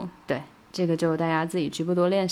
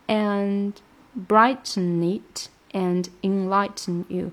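slow, careful "brighten it and enlighten you"; slowed teaching read; slow clear reading; much slower than usual. Shown as a demonstration. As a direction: spell it out slowly. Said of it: "这个地方被遮住了".

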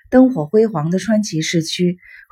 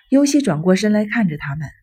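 ordinary delivery, nothing marked

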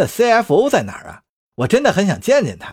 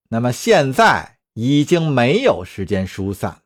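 plain reading voice

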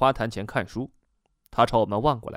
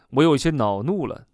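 plain reading voice

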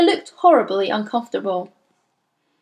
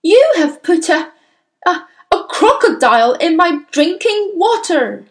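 high, loud, spluttering outrage; incensed, loud headmistress; yelling back; 8 semitones higher, 7 dB louder than usual. A narrator's reading. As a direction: yell the accusation, stumbling with fury. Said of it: "the Trunchbull yelled back".

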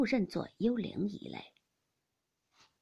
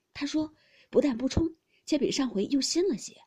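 higher, louder, lively storytelling voice; eager young gentleman; 4 semitones higher, 6 dB louder than usual. A narrator's reading. Baoyu telling his friend about the grave offerings, eager and high. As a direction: recount it eagerly, pitched up.

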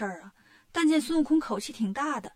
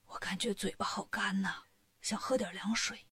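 softer, parched whisper of a sick monk; dry-mouthed and feeble, barely audible; ailing monk asking his disciple for water; weak sick whisper; 3 semitones lower, 7 dB quieter than usual. Acted. Sick monk asks weakly.

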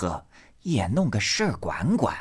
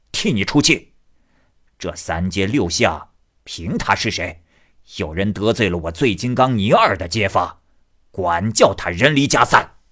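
put-on swaggering drunk ruffian; 5 dB louder than usual.

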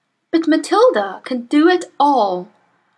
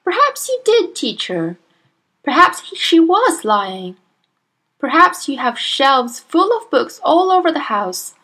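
pointed sarcasm; 6 semitones higher, 5 dB louder than usual. A narrator's reading.

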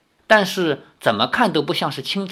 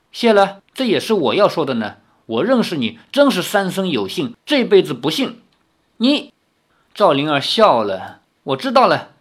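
raised, lively storybook reading; story narration; 5 semitones higher, 4 dB louder than usual. A narrator's reading. Narrator reading the quarrel of three young friends aloud. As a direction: read the novel scene aloud with lively voices. Reads novel aloud with voices.

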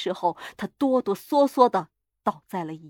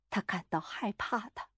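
ordinary delivery, nothing marked

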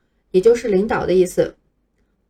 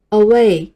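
away with the weight on "way", louder; light "a", then hit "way" in away; teacher's model away, second syllable stressed; 5 dB louder than usual. Shown as a demonstration. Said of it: "这个重音符号后面的音需要重读".

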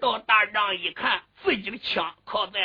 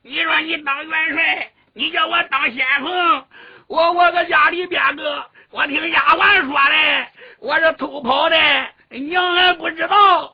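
higher-pitched, excited younger brother, gabbling his questions; eager little brother; 5 semitones higher, 5 dB louder than usual. Acted. Little brother finds big brother after sneaking from home, plaintive and excited. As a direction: pour out a little brother's complaint in a raised voice.

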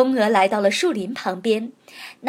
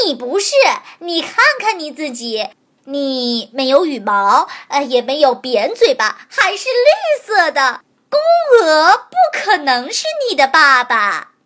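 crowing rooster voice; 5 semitones higher, 7 dB louder than usual. Acted. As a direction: bellow it like a crowing cock. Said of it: "像打鸣一样扯着嗓子".

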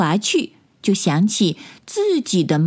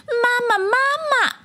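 put-on very high childlike calling; tadpole calling out. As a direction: call for mama as a tiny tadpole. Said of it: "就追上去喊". (小蝌蚪尖声喊妈妈)

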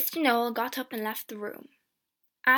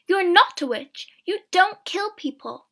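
raised, high-pitched denial; crying out; 8 semitones higher, 9 dB louder than usual. A narrator's reading. Sister insists her brother is no witch, crying out. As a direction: protest loudly, voice lifted. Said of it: "I cried".

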